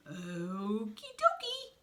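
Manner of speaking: slow, drawn-out character voice; easygoing Shark figure. A narrator's reading. Reader voices Shark answering Snake's frantic order, easygoing. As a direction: stretch it out slowly as an unhurried reply.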